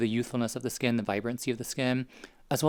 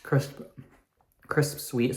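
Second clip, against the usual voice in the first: talking with mouth full; slower than usual. Real, not acted. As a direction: speak around a bite of apple. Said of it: "Sorry, I'm talking with my mouth full".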